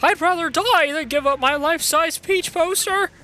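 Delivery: high wailing despair; despairing villain. Acted. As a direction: overdramatic heartbroken king. Bowser despairing over poster.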